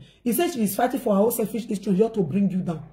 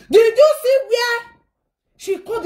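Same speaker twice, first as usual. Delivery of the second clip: shocked; 10 semitones higher, 5 dB louder than usual. Real, not acted. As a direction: sound stunned at what happened. Shocked at sister's call.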